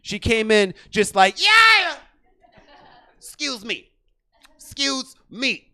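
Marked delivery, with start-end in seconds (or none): 1.4-2.0 sudden high shouted yeah. Retold or imitated. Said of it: "she sounded exactly like Little John". 3.2-5.7 higher, strained, slow excuse me; tweaker voice. Retold or imitated. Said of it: "she sounded exactly like Little John".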